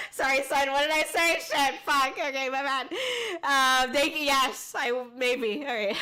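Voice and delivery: embarrassed laughing apology; flustered laugh. Real, not acted. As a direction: laugh off the slip. Flustered after slip-up.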